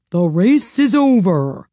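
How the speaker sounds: loud, slow announcement; calling out. A narrator's reading. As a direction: call it out to the field. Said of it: "the dodo suddenly called out".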